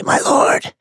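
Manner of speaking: ragged, rasped cry to a master. A narrator's reading. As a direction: call out in a ragged, voiceless rasp. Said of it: "hear a ragged voice say".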